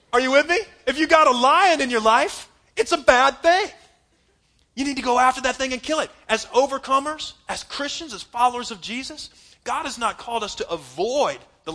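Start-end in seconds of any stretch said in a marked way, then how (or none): 0.1-9.3 loud, high rising preacher's cry; fiery preaching. Real, not acted. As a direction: pastor urging the church, full-force preaching. Preaching at full force.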